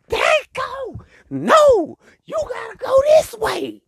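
put-on high, pushy girl voice giving directions; bossy girlfriend voice. One friend in the group steering everyone, high and bossy. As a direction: boss the group around in a high girly voice. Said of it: "She be like".

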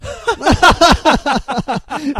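high, hammy cackling laugh; mad scientist. Shown as a demonstration. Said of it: "ellos interpretan la risa".

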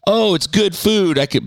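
high, prissy mock voice of a do-gooder; sing-song caricature of someone who hates waste. Mocking a do-gooder.

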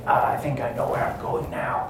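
hushed, breathy sneaking whisper; secretive near-whisper; whispering to himself. Acted. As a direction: whisper as you creep through a dark vent. Charlie alone, sneaking through vents.

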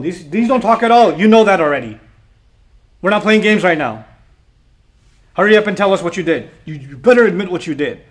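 loud, forceful demand; angry interrogation. Acted. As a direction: act out a crowd losing patience. Acting the angry crowd's interrogation.

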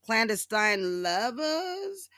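very high, slow sarcastic hums; sarcastic musing. Retold or imitated. Mocking Trump's scandals to viewers in very high, slow sarcasm. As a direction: ham up mock innocence. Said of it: "and I'm like".

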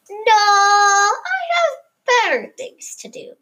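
said dubiously. A narrator's reading. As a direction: stretch it out, unconvinced. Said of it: "he said dubiously".

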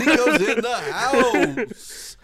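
loud, slow, drawn-out hype call; hype announcer. Acted. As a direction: pump up the crowd.

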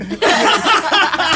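loud high burst of laughter; big laugh. Real, not acted. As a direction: crack up laughing. Punchline of funny story.

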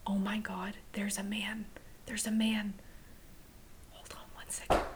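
hushed, breathless whisper; startled whisper. Real, not acted. Host spotting stranger at window, startled whisper. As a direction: whisper so the man won't hear.

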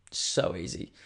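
breathy whispered aside; hushed murmur, then punch it next take. Acted. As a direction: say it under your breath, smug. Repeats the phrase, first hushed.

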